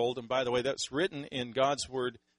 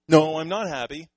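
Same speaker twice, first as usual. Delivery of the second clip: mock retort; 4 semitones higher, 12 dB louder than usual. The other side answers back.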